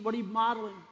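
ordinary delivery

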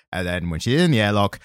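ordinary talk, nothing marked

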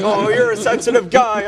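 high, sweet mom voice; a mother cooing at her son; imitating mom's high teasing coo.

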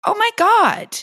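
high-pitched mock astonishment; acted amazement. Trainer reacting to old pictures of herself, acted amazement.

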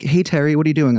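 a caller mistaking him for his mom; lightly voiced cheerful caller; imitating a caller. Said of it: "She'd be like".

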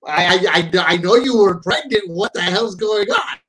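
loud, high, incredulous questioning; retold astonishment. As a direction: act out being floored by the news. Acts out own astonished reaction.

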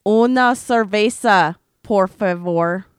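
loud, slow tourist Spanish; hammy gringo ordering a beer; dad's clumsy Spanish. Retold or imitated. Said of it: "My dad".

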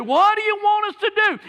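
high, mocking whine of the compliant; mock timid plea. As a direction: sneer it as a spineless question. Mocking the submissive question.

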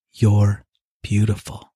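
slow, tender reassurance; slow, tender reassurance to her friend. Acted. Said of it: "she says, one more time".

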